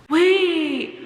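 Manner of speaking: high, slow, shouted alarm; imitating blurting out about a crying boy; mock shocked shout. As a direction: shout it out like a scandal. Loud mock alarm in class.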